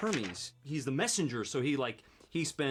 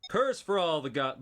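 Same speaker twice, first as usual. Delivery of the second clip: higher, louder stagey curse; character voice; 6 semitones higher, 8 dB louder than usual. Acted. Actor insisting on doing something, theatrical character voice. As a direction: launch it big as a character. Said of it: "I need to do something".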